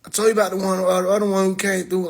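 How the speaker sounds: ordinary talk, nothing marked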